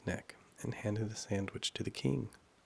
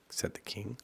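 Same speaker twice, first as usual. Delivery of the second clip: ordinary delivery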